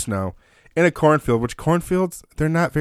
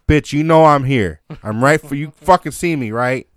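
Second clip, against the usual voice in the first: loud, exasperated call across the creek; louder, irritated shout to a far-off dog; yelling at the dog; 3 semitones higher, 7 dB louder than usual. Retold or imitated. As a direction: bellow at a dog that's ignoring you. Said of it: "ten minutes of screaming".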